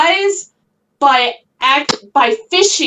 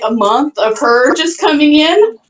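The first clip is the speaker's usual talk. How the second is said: usual speaking voice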